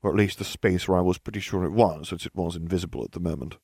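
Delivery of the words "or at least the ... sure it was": usual speaking voice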